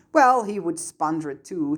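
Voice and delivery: plain reading voice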